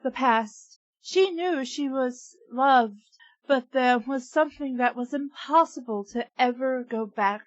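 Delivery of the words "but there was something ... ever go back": ordinary talk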